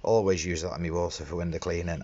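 usual speaking voice

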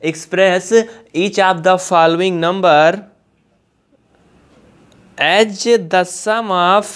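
very slow, word by word with long pauses; slow dictation. Shown as a demonstration. Teacher dictating the question to students, very slow. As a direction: read it out slowly so they can write.

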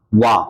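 wa said alone, cut off before the word finishes; isolated WA sound. Shown as a demonstration. Teacher isolating the start of "one", wa said on its own. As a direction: give just the opening sound wa, then stop. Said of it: "তাহলে কিসের মতো উচ্চারণ হয়েছে".

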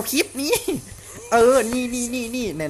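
loud, high excited callout; excited shout. Real, not acted. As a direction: yell it out in the heat of the fight. Excited callout during fight.

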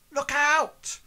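loud, high-pitched yell; warning shout. Acted. Game master echoes Gulliver's warning to Hazra, shouted. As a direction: cry out a warning at full voice. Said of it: "You hear Gulliver shout".

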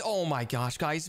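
ordinary talk, nothing marked

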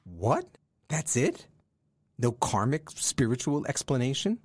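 let-down disbelief, pitch shooting up at the ends; incredulous. Acted. Disciple expecting profound wisdom gets a simple answer, incredulous. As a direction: question it in disbelief, each phrase rising sharply.

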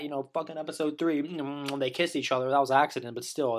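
ordinary talk

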